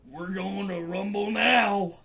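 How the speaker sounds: slow, loud threatening growl; slow and loud, a tough guy daring them; menacing growl. Retold or imitated. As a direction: rumble it out like a movie villain. Menacing biker voice quoted.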